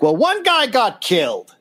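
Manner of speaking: high-pitched mocking quote; mock earnest. Mocking Lincoln over one murder, high mock-earnest voice. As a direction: push it high, incredulously overblown on "guy". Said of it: "and he's like".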